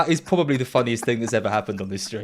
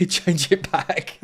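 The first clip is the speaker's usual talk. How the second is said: laughing; 4 semitones higher, much slower than usual. Real, not acted. Laughing, asking for the robot again.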